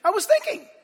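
high, loud mock defence; defensive protest. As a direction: protest your innocence, a bit whiny. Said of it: "Why did you think I was upset".

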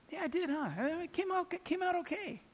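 preen, falsely modest and high; smug pleased self. Retold or imitated. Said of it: "preacher voicing his proud self".

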